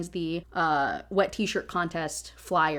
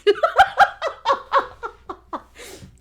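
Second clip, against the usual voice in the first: big laugh; 7 dB louder than usual. Real, not acted. Laughing at the book's line.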